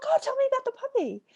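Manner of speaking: light up with eager interest; excited. Retold or imitated. Coach perking up at the mention of a puppy, delighted.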